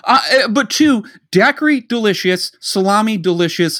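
high, loud tasting verdict; mock food critic. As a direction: rave like a foodie, tongue in cheek.

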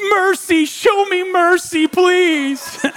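mock melodramatic wail, high and drawn out; hammed-up plea of a kid escaping punishment; dramatic child begging. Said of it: "He's so dramatic".

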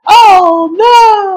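loud, high cry of dismay; mock horror. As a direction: gasp out oh no in fake shock. Acted dismay at fate.

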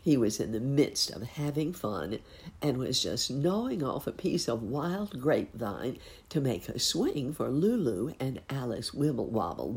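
usual speaking voice

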